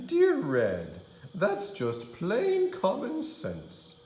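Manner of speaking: very high laughing granny voice; wolf as granny. Acted. Wolf as Grandma shrugging off the biceps. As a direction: giggle it off like a fake granny.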